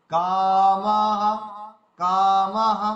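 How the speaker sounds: slow, sung "কামাহা" with both a vowels held; long vowels. Shown as a demonstration. Teacher showing two long syllables, "কামাহা" stretched. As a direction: draw out "কামাহা" as kaa-maa-ha, loudly. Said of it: "দুটো দীর্ঘ উচ্চারণ হবে।".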